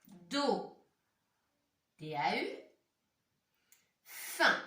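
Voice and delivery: "Do", the letters "D A U", then "Fin", with long pauses; sounding out. Shown as a demonstration. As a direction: read and spell "Do. D A U. Fin" piece by piece. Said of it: "Essayez bien de lire les mots".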